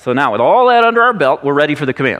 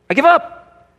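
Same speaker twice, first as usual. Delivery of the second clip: high, exasperated I give up; exasperated quit; 10 semitones higher, slower than usual. Retold or imitated. Frustrated spouse quitting on love, exasperated high. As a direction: throw up your hands and quit. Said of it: "Those just".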